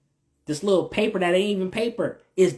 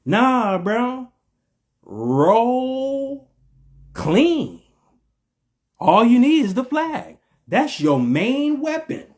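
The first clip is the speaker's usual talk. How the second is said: emphatic preaching; 8 semitones higher, slower than usual. Real, not acted. Emphatic correction to listeners.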